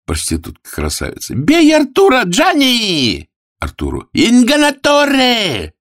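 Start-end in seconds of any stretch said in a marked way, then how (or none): 1.3-3.3 very high, loud shriek; inciting shout. Acted. Prostitute urges Gianni against Arthur, shrieking high. 4.1-5.7 high, loud drawn-out yell; angry insult. Acted. Woman denounces Arthur as a cheat, high yell.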